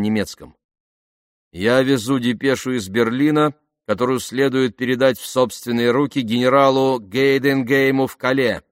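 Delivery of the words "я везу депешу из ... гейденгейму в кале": raised, forceful official reply; officious officer. A narrator's reading. Disguised officer answers checkpoint soldier, officious and firm. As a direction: state your business with authority.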